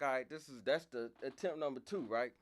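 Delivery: building frustration; worked up. Retold or imitated. Counting his failed attempts.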